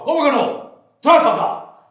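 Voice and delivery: alto y fuerte, arrebatando; orden brusca. Acted. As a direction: exige a gritos. Asaltante arrebata el dinero. (el asaltante le arranca el dinero a gritos)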